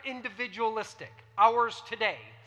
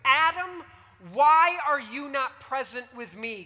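louder, pleading call of "Adam"; God calling Adam; 2 semitones higher, 7 dB louder than usual. Acted. God asks Adam why he is not there, louder pleading. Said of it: "God asks".

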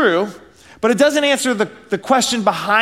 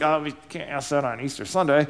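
a hint of a prim churchgoer, softly; lightly voiced scandalised believer; a touch of mock shock, half-muttered; mock pious objection; 5 semitones lower, 7 dB quieter than usual.

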